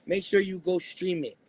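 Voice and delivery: ordinary delivery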